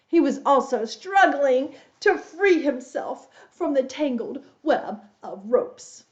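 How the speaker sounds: plain reading voice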